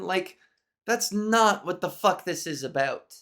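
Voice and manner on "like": normal talking voice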